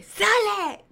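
high, drawn-out angry cry; furious yell. Retold or imitated. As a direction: throw the intruder out, retold with fury. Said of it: "Pero con furia". (grito agudo con furia)

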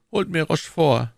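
ordinary delivery

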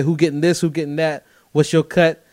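ordinary talk

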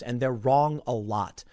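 ordinary talk, nothing marked

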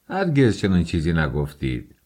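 plain reading voice

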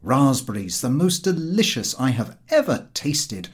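plain reading voice

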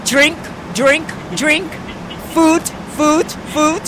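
imitating a German accent, one word at a time; mock German accent. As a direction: bark each word like a terse German attendant. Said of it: "he was saying".

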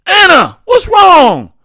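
high, very loud, desperate plea; shouted plea. A narrator's reading. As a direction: plead at full shout for an answer. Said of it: "he pleaded".

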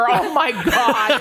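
slow laughing oh my god. Real, not acted. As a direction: laugh through it. Laughing at product.

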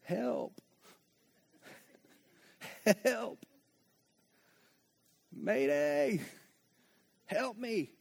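soft, small cries of help, half laughing; a hushed pretend mayday; quiet mock distress call. Preacher acts out a sigh as a distress signal, soft and small. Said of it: "It's like a distress signal to God".

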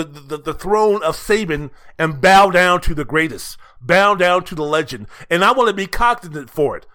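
ordinary delivery

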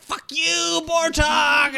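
high, drawn-out yell; hammed-up vengeful holler; angry shout. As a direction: shout the curse in mock fury. Acted curse at escaped villain.

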